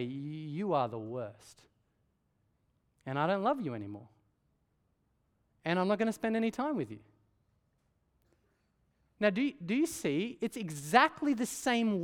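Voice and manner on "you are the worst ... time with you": loved one's hurtful words. Retold or imitated. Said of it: "the people you love the most".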